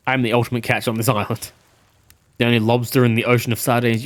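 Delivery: low-pitched, self-important schemer; cocky game character pitching himself low; boastful big fish. Acted. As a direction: brag in a low, steady voice.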